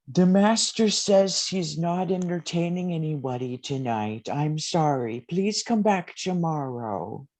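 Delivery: put-on high, thin old butler voice; very old man. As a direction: play a frail old butler. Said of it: "A different voice".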